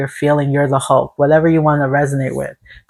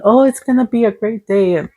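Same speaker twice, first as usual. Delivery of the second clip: mock cheerful; 7 semitones higher than usual. Bypassing pain with a mock cheerful chirp. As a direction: fake upbeat, pitched up high. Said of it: "You're not saying".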